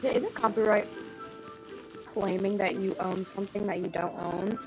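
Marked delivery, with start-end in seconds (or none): none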